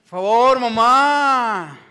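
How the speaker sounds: imitating an impatient son calling his mother, high and drawn out; mock yell pitched well up, calling out to mamá; retold shout. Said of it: "apenas le grita".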